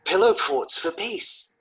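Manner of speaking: drawn-out, high, hopeful suggestion; eager pitching. Acted. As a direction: sell the idea with slow, hopeful wonder. Acting eager idea to partner.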